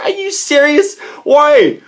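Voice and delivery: high, loud, incredulous demand; fired up. Retold or imitated. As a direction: protest in worked-up disbelief. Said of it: "getting all fired up".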